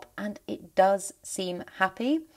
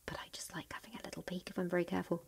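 quick hushed whisper while peeking; 13 dB quieter, faster than usual. Real, not acted. Plant lover whispering while sneaking a peek at the roots. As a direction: breathe it out secretly as you sneak a look.

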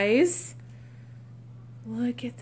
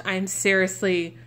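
normal talking voice

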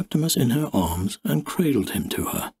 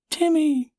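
put-on much higher child's call; little girl calling. A narrator's reading. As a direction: try out the new name like an eager small girl. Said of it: "she tried".

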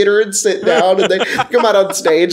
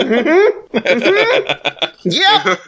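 smug laughing mm-hmm; 11 semitones higher, much slower than usual. Retold or imitated. DM smugly agreeing, laughing.

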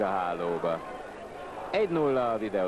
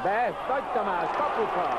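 excited goal call; 8 semitones higher, faster than usual. Real, not acted. Goal scored in commentary.

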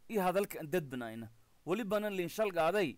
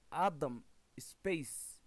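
slow dictation; much slower than usual. Shown as a demonstration. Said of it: "dictating email address".

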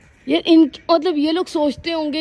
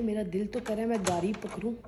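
usual speaking voice

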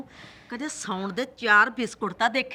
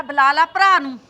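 louder, falling on ਭਰਾ; defiant dare; 7 dB louder than usual. Acted. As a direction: repeat the dare harder. Mother-in-law dares her. (ਸੱਸ ਦਾ ਲਲਕਾਰਾ)

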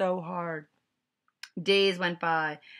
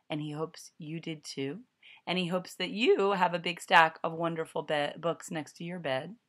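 ordinary delivery, nothing marked

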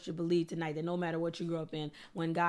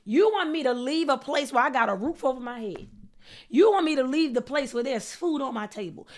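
higher, louder preacher acting out disbelief; acted-out indignant pleading, pitched up; incredulous protest; 5 semitones higher, 6 dB louder than usual. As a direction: hammer each objection from the top. Voices Abram's protest to God.

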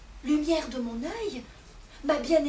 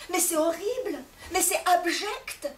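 building, slower, higher indignation; husband protesting the cruel demand; mounting outrage; 5 semitones higher, 4 dB louder than usual. Acted. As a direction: rise in scandalised protest.

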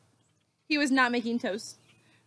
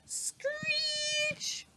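toaster screech sound; 6 dB quieter than usual. A narrator's reading. Toaster being dragged across counter.

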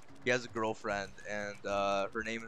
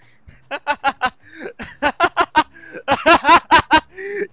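long high laughter growing louder; building elated laugh; 11 dB louder than usual. Real, not acted. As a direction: laugh in bursts that climb to a loud peak. Elated laugh at apparent stage end.

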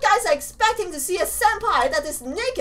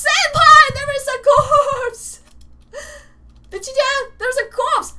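excited anime fan; 5 semitones higher, 5 dB louder than usual. Real, not acted. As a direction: call out to him eagerly. Calls out to senpai.

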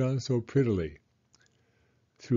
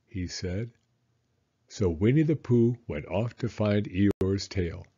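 ordinary delivery

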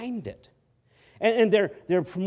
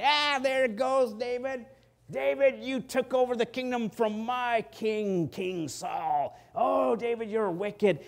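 a taunting enemy voiced higher and slower; Shimei taunting David; 7 semitones higher, slower than usual. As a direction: jeer at the fleeing king from the hillside. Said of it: "he was going to say".

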